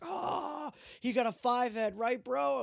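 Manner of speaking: high, loud gleeful crowd imitation; hooting, egging on. Cops reacting to the big head joke, hooting and egging on.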